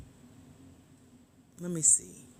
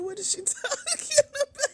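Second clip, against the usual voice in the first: laughing; 4 dB louder than usual. Retold or imitated. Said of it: "I was like".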